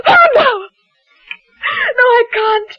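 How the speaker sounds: wife breaks down crying in panic. Acted. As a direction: break down and cry out. Wife breaks down mid-confession.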